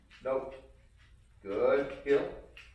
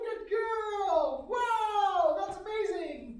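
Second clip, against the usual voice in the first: very high, gushing praise; sky-high excited dog praise; high-pitched praise; much slower than usual. Real, not acted. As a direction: squeal delight at the dog.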